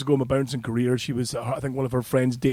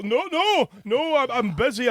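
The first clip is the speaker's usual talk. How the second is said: flustered high refusal; 8 semitones higher than usual. Retold or imitated.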